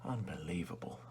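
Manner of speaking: breathy whispered disbelief. Acted. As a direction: whisper it to yourself. Whispered aside in roleplay.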